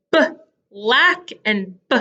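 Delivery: B split off from LACK by a pause; teacher isolating the B in B, lack; sounded-out onset. Shown as a demonstration. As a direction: say B alone, wait, then lack. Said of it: "They both say b".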